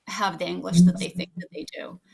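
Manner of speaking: unvoiced wry whisper; whispering a sly aside. Real, not acted. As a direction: drop to a conspiratorial whisper. Wry aside about students.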